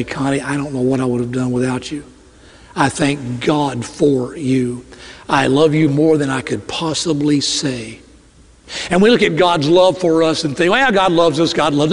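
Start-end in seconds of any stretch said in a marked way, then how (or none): 0.0-8.0 heartfelt, tender. Retold or imitated. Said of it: "I look at her and say".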